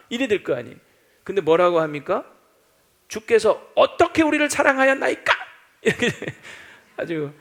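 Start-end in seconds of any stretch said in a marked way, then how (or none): 3.1-5.3 high, biting challenge; sharp retort. Retold or imitated. Israel questioning God, sharp and biting. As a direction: snap the question back. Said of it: "톡 쏘면서".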